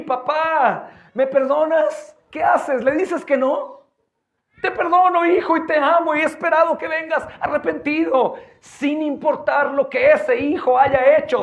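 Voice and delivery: high, loud impassioned preaching; fervent sermon at full voice; preaching at full force. Acted. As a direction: drive it home with fire.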